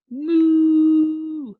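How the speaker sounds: big loud mooing cow. Pretend. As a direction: moo like a cow. Cow sound for kids.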